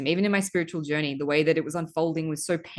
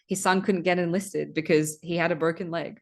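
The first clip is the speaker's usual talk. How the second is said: ordinary talk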